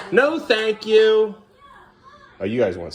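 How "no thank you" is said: drawn out and a bit higher, no thank you; slow modeled answer. Shown as a demonstration. Parent modeling the polite answer for the child, slow and drawn out. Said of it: "do you need to use the potty?".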